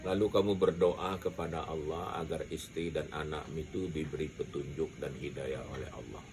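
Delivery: normal talking voice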